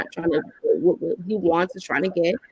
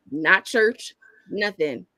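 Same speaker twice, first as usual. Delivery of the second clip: usual speaking voice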